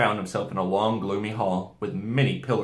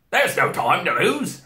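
loud, higher, slow exclamation; urgent Mister Beaver; 8 semitones higher, 6 dB louder than usual. A narrator's reading. Beaver urging everyone to flee, raised urgent voice. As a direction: announce it urgently.

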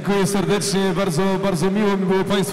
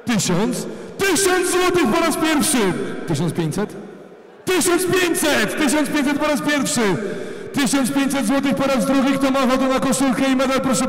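high, ringing auction calls; auctioneer; 5 semitones higher than usual. Real, not acted. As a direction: sell it to the room.

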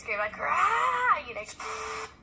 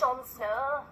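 lightly imitated northern English accent, softer; 6 dB quieter than usual. Actress quotes Game of Thrones, mock northern English accent. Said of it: "He has that northern English accent".

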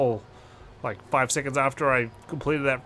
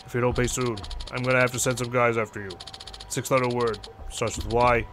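menacing loan shark; 2 semitones lower than usual. Acted. Voices the loan shark caller.

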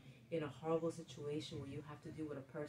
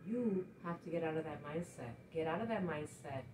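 normal talking voice

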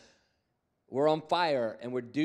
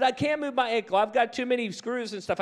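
high, loud protest; retold protest, pitched up; doubtful protest; 9 semitones higher, 4 dB louder than usual. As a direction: insist he can't move it. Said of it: "He said".

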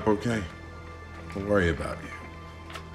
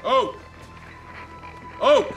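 shouting. Acted. Calling up to someone on boat.

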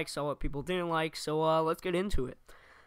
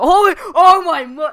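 high, loud fake scream; scared horror game streamer; 12 semitones higher, 16 dB louder than usual. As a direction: shriek at the scare. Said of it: "scream a lot".

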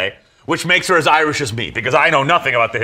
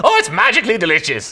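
higher, hammed-up Irish catchphrase voice; cartoonish Irish lilt, pitched up; mock Irish accent; 6 semitones higher, 3 dB louder than usual. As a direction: parody someone's go-to Irish impression. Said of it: "that's the go-to impression".

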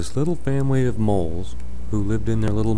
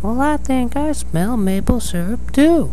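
Mama Mole. Acted. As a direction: play the mama mole, pitched way up. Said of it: "Mama Mole said".

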